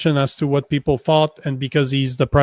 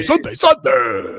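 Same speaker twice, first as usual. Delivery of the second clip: high shouted then dropping chant; monster truck ad; 10 semitones higher than usual. Retold or imitated. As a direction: belt it like a truck rally ad. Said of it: "can't stop myself every time you say Sunday".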